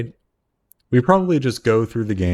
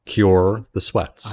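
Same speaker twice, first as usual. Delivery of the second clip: each word stretched out slowly; slow insistent plea; 3 semitones lower, much slower than usual. Acted. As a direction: drag out "Cure the sweats" slowly, insistent. Repeated request, dragged out.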